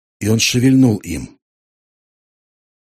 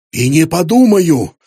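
higher, louder, slow defiant yell; cracking shout; 6 semitones higher, 5 dB louder than usual. Acted. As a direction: shout back, voice breaking. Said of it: "срывающимся голосом закричал в ответ Шлак". (срывающийся крик)

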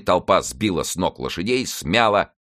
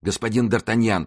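plain reading voice

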